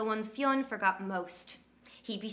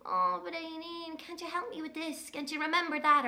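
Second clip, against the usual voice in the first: a hint of a droopy-faced boy asking for help; sad little brother; 7 semitones higher than usual. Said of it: "with his sad upside-down face saying".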